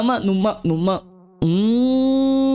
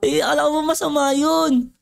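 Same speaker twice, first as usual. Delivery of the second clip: ordinary delivery, nothing marked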